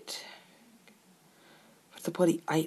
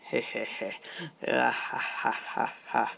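gloating evil cackle; evil chuckle; 2 semitones lower, slower than usual. Real, not acted. Gloating as his combo goes off.

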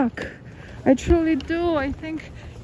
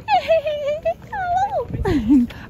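high squealing laugh. Real, not acted. Laughing at failed Elmo.

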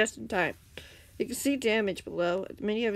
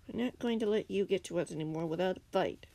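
usual speaking voice

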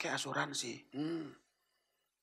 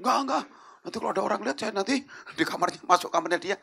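high, loud panicky protest; hammed-up alarm; flustered refusal; 9 semitones higher, 10 dB louder, faster than usual. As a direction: object urgently, acting out a worried man. Pastor refuses to be left alone.

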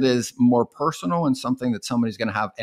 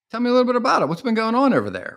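eager interest; 11 semitones higher, much faster than usual. Shown as a demonstration. Sales caller shows interest.

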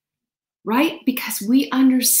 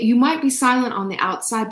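ordinary talk, nothing marked

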